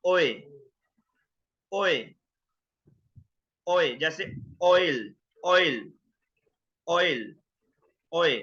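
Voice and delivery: "ओए" and "ऑयल" repeated as a clear oi glide; oi diphthong. Shown as a demonstration. Teacher drilling the oi sound for students. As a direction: drill "ओए" slowly like oil. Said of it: "ये होगा".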